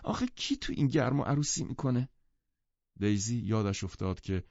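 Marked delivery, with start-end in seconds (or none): 0.0-2.1 high-pitched moan of complaint; whining complaint. A narrator's reading. Jordan hearing a wedding march in the heat, whining. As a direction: whine it, pitched up. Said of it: "جردن نالید که".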